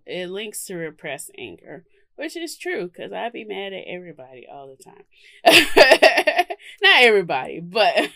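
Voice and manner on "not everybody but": laughing. Real, not acted. Laughing at own anger joke.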